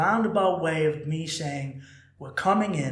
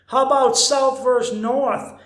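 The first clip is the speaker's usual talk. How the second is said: higher and louder, hyping the crowd; rowdy bar pianist egging on the crowd; hyped piano man; 7 semitones higher, 8 dB louder than usual. Retold or imitated. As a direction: work the bar like a showman. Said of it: "the guy goes".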